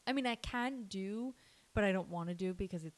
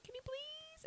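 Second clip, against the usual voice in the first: much higher, softer relieved plea; pleading squeak; 11 dB quieter, slower than usual. Retold or imitated. New nurse begging a colleague to take report, squeaky. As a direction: beg in a tiny high voice. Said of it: "And I was like".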